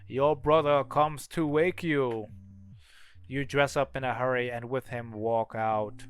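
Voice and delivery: ordinary delivery, nothing marked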